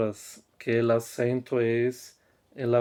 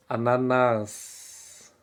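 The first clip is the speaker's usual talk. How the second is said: slow teaching demo; much slower than usual. Shown as a demonstration. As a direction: draw out "ananás" slowly and clearly, syllable by syllable. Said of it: "teacher models the plural slowly".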